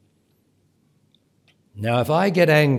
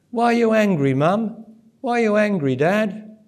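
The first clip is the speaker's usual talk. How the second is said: put-on children asking; 8 semitones higher than usual. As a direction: play the puzzled kids asking their parents. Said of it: "and the children will say".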